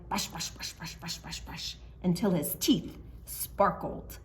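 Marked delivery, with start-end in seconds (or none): 0.1-1.8 soft rhythmic whisper; whispered brushing. A narrator's reading. Brushing lion's teeth rhythmically.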